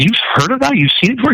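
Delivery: retelling his own astonished question; incredulous disbelief. Retold or imitated. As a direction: pitch jumps high in disbelief. Stunned reply to the mechanic.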